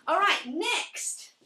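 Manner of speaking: calling out. Real, not acted. Instructor calling the class to the next exercise, loud and slow. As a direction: stretch it out to the whole room.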